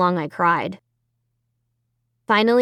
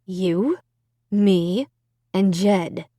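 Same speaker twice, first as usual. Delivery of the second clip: gritted teeth; much slower than usual. A narrator's reading. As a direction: keep calm through clenched jaw. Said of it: "spoke through gritted teeth".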